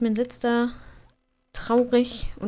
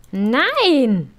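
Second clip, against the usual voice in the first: shout; 2 semitones higher, 7 dB louder than usual. Real, not acted. Sim eating the cake again.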